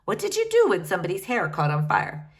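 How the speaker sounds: plain reading voice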